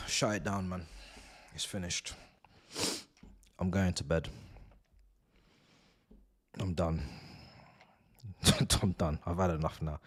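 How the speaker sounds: low, soft, spent mutter; defeated, sinking into a low murmur; weary resignation. Real, not acted. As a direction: sigh and give up quietly. Giving up on the match.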